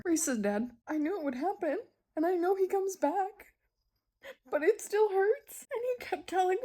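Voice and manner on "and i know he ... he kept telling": higher, tearful voice; crying. Real, not acted. Grieving a character's death while crying. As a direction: push through the tears, voice lifting high. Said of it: "I cried some more".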